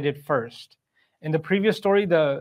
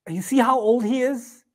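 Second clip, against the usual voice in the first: acted astonishment; 8 semitones higher than usual. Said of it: "you could say out of ta'ajjub".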